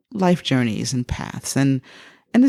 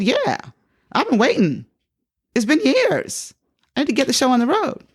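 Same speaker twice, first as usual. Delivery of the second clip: high, emphatic retold insistence; playfully exasperated and raring to go; impatient eagerness; 8 semitones higher than usual. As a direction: insist you've waited long enough, pitch up. Retold eagerness after years of waiting.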